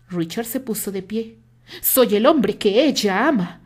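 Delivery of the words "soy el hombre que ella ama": slow and louder, leaning on "ella ama"; slow, weighted emphasis on "ella ama"; emphatic. A narrator's reading. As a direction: press every word of "Soy el hombre que ella ama" home. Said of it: "Terry enfatizó sus últimas palabras".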